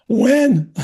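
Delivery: high, loud one-word question; retold disbelief. Actor reeling at news from boss, high disbelief. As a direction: ask it reeling, pitched right up.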